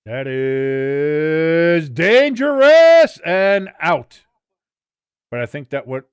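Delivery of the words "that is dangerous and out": drawn-out, rising play-by-play; building match call. Real, not acted. Commentator follows dangerous ball going out, stretched building call. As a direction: stretch the call as tension builds, growing loud.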